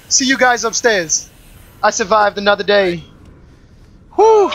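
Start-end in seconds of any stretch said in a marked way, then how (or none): 4.1-4.5 high, loud sigh of relief; relieved whew. Real, not acted. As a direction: blow out the tension. Relief after surviving.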